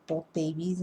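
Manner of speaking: ordinary talk, nothing marked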